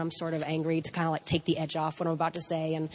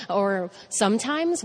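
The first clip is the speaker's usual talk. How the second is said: normal talking voice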